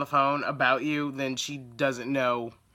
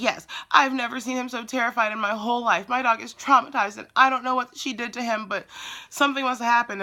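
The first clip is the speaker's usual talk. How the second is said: lightly voiced indignant customer, higher pitched; mock an aggrieved caller, pitched up; retold complaint in a higher voice; upset customer; 6 semitones higher than usual. Acting the upset dog owner.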